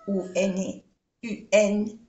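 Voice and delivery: ordinary talk, nothing marked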